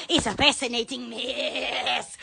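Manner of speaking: slow, soft and low; hushed, stretched-out relish; low, drawn out. Real, not acted. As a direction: savor it slowly, dropping down low.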